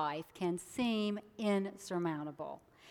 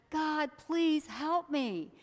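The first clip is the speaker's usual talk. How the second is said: pleading; 7 semitones higher, much slower than usual. Acted. Man begs God.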